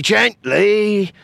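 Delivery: sudden high, loud warning; alarm shout. Acted. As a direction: snap it out in alarm. Alarm as mine is prodded.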